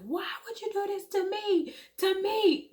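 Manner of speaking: high, anguished cry to the sky; yelling out in raw hurt; retold yell. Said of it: "I remember just yelling out".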